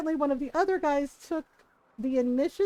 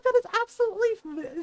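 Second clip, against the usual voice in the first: imitated outrage; 8 semitones higher than usual. Stepmom appalled, acted out.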